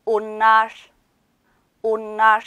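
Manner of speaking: 온날 said carefully as Turkish "onlar"; teacher's isolated, evenly pitched 온날; pronunciation model. Shown as a demonstration. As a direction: model 온날 in two clear syllables, ending on a Turkish r. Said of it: "요렇게 발음이 돼요".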